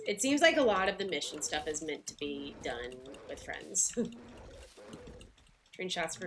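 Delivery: normal talking voice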